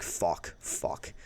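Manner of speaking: low, breathless whispered curses; panicked whisper. Retold or imitated. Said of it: "I'm freaking out".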